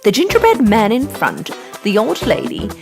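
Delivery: ordinary delivery, nothing marked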